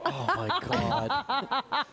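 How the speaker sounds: slow drawn-out laughing oh my God; real amused laughter. Real, not acted. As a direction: laugh through the words.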